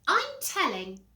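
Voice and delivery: high, loud whine; tattling little brother. Acted. Peter threatening to tell Mum, high tattling whine.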